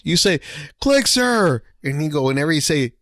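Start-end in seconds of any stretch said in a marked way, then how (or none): 0.8-1.6 "Click, sir." barked higher and loud; recruit's shouted reply. Retold or imitated. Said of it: "every time a drill instructor would say".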